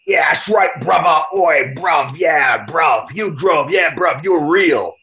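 mock the British fans hyping him up in a British accent; British fanboys cheering, half-hearted British accent; mock British lads. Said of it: "he's got all these people now".

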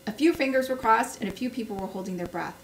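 plain reading voice

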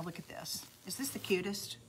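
breathy hushed whisper of delight; awed whisper over the finished board. Real, not acted. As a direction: whisper it like a secret marvel. Admiring finished board, hushed then thrilled.

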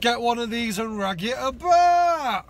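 loud, high, drawn-out hype shout; gleeful shout. Pretend. Urging viewers to buy one, gleeful shout. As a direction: belt it out, stretching the end.

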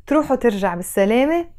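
fast Arabic farewell to a guy. Shown as a demonstration. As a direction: rattle it off quickly. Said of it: "I'm gonna say it a bit faster".

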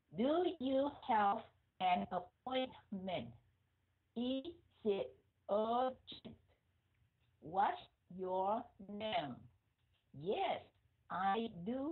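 very slow model reading, each word separate. Shown as a demonstration. Teacher wants careful reading from learners, slow separated words. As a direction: read it out slowly, word by word. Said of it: "phải đọc chậm rãi từng chữ".

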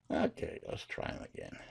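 low, unvoiced aside between calls; muttered aside. Real, not acted. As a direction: mutter it softly to yourself.

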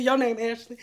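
ordinary talk, nothing marked

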